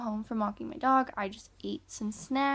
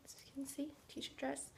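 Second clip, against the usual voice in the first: whispering; 4 semitones higher, 15 dB quieter than usual. Real, not acted. Whispering while filming quietly.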